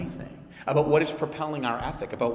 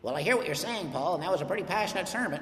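mock critic voice; 3 dB quieter than usual. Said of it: "none of you have voices like that".

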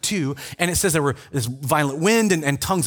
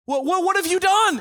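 high-pitched, scandalized interrogation; mock indignant questioning; 14 semitones higher than usual. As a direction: play an outraged official, pitched way up. Acting religious leaders questioning Peter.

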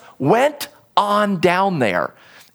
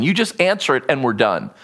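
normal talking voice

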